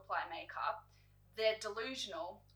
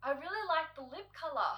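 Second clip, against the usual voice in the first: forced compliment; 7 semitones higher than usual. Retold or imitated. To the makeup artist, forcing a polite compliment, higher. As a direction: squeeze out the one nice thing, higher. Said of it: "I am just like".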